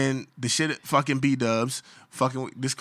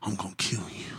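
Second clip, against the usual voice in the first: whispered, softer menace; hushed threat; 5 semitones lower, 8 dB quieter than usual. Retold or imitated. Man threatening the coworker on the phone, whispered. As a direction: breathe the threat quietly. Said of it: "was like".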